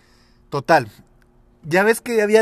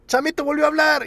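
high tattling shout; shouting accusation; 13 semitones higher, 4 dB louder than usual. Retold or imitated. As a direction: call him out to the class. Kids accusing Chamito of talking.

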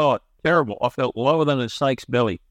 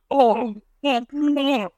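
mock high reaction; 13 semitones higher, much slower than usual. Retold reaction to God question.

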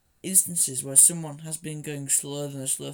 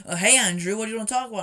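loud eager interruption; 5 semitones higher, 11 dB louder, faster than usual. Retold or imitated.

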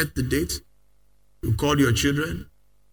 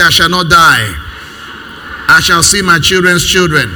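louder, fuller shout of faith; said like you mean it; 2 semitones higher, 9 dB louder than usual. Shown as a demonstration. Pastor urging the church to repeat it with conviction, full shout. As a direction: say it again and mean it. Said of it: "Say it like a minute".

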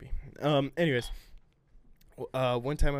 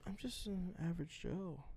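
whispering; 4 semitones higher, 13 dB quieter than usual. Pretend. Joking self-description.

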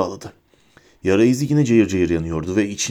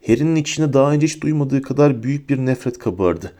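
usual speaking voice

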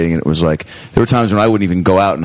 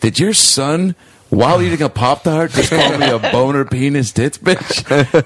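higher, drawn-out disbelief, imitating an affronted adult; raised pitch, slowing into mock outrage; retold incredulity; 6 semitones higher, slower than usual. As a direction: imitate a stunned parent demanding an explanation. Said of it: "with a face that was like".

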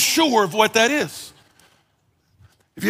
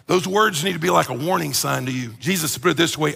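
ordinary talk

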